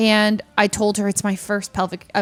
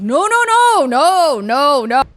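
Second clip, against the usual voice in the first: high, loud mock-panicked no's; panicked refusal; 5 semitones higher, 7 dB louder, slower than usual. Refusing the offer at the clinic, high and loud. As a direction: wave it off in a shrill-free but high alarmed rush.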